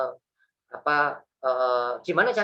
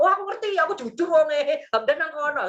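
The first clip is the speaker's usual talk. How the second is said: mock naive blurting; 10 semitones higher than usual. Naive honest man blurts where Hamdan hides, silly high voice. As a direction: play the fool who gives him away.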